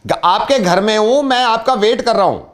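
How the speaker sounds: high-pitched, emphatic prank caller; insistent builder. Pretend. Prank builder to owner from inside his house, insistent.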